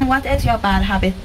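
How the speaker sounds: ordinary talk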